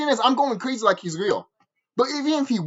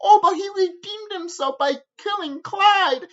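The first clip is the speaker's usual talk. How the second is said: mocking fans; 12 semitones higher, slower than usual. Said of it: "you feel sorry for Cartman".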